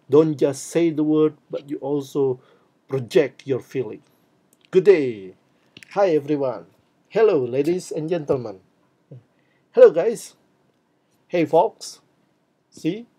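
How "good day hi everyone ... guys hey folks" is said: loud, lively, projected greetings; energetic greetings. Shown as a demonstration. Teacher demonstrating greetings for students, loud and lively. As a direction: sell every hello with energy. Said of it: "Energy. Show your energy".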